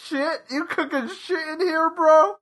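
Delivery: disgusted, high-pitched bro sneer. Retold or imitated. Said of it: "someone making a normal meal".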